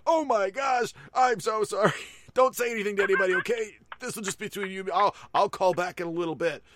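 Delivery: high, loud, fast panicked apology; a store clerk caught spoiling a surprise; shrill-free high embarrassed gush; flustered salesman. Retold or imitated. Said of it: "And the guy goes".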